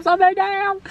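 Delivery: playful, exasperated complaint. Real, not acted. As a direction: moan "ça m'énerve" in mock exasperation.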